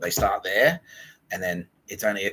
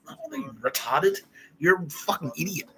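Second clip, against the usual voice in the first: high, strained mock scolding; mock angry abuse; 7 semitones higher than usual. Insulting someone in the acted tirade, mock angry abuse. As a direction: scold in a hammed-up, pitched-up voice.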